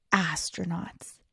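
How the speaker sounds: usual speaking voice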